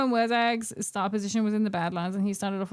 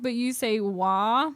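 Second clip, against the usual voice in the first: ordinary talk, nothing marked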